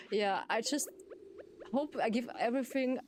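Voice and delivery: usual speaking voice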